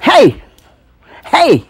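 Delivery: loud, high hollered hey; hollering. Retold or imitated. Granddaddy hollering at the child from the door, loud. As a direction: yell from the doorway. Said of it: "hollering at me".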